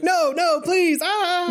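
high, squealed comic begging; mock panicked pleading. Helpless bystander begging to be spared, high mock panic.

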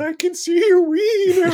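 high wailing ghost voice, laughing; ghost impression. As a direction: shriek it like a spooky ghost. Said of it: "did you not hear my ghost impression".

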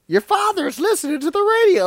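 high, loud hushed-mom impression; mock mother voice. Friends mocking the movie mom's line, high mock voice.

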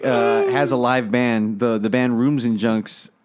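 ordinary talk

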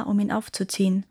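ordinary delivery, nothing marked

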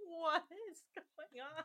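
high, slow, drawn-out bafflement; bewildered friends. Acted. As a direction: act out baffled friends listening in. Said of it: "my Canadian friends are gonna be like".

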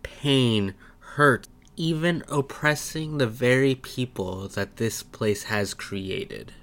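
soft, grave storyteller read of a harsh city; dramatic intro narration. A narrator's reading. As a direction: set the scene like a trailer voiceover. Dramatic opening narration of setting.

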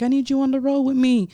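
high, loud retold invitation; eager invitation. Artist invites her on the road, eager high voice. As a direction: pitch it up, big and insistent.

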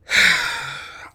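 long unvoiced sigh; tired sigh before answering; weary sigh. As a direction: breathe out the irritation. Tak sighs at bully.

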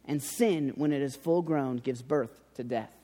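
low, soft and measured reading of a verse; scripture reading. Acted. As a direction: lay the verse down quietly and gravely. Opening scripture reading from James.

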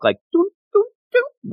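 high tiptoeing doot sounds; sneaky doot doot. Retold or imitated. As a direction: hum a sneaky tiptoe tune. Sneaking past the guard.

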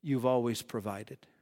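quiet faith-filled prayer; prayerful trust. Retold or imitated.

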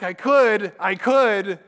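loud, insistent mock protest; worked-up self-justifying outburst. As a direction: plead the case with force. Acted protest to God.

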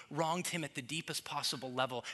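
normal talking voice

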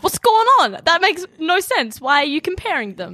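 confused surprise. Retold or imitated. Friend reenacting her confusion over Kelly. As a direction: sound utterly thrown by it. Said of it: "I was like".

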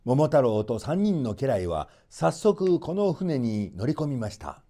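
usual speaking voice

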